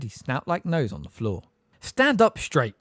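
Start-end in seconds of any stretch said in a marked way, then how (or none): 1.8-2.7 high, loud impatient command; impatiently. A narrator's reading. Master ordering the bowing elf, impatient command. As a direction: order him up sharply. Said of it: "said Sirius impatiently".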